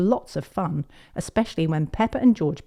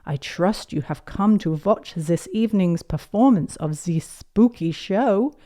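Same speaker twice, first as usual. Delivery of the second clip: hammed-up vampire; 2 semitones higher than usual. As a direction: play the vampire host grandly. Grandpa continues his vampire act.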